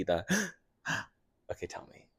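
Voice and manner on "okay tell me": gasping, whispered reply. Retold or imitated. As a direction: gasp then whisper eagerly. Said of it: "She was like, gasps".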